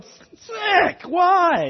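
high squealing yelp of disgust; hammed-up gross-out squeal; mock disgust. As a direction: recoil with a high disgusted cry. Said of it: "you're sitting there going".

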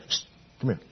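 psst, a quiet call over; hushed beckoning. Pretend. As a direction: softly summon the flight attendant. Beckoning the flight attendant.

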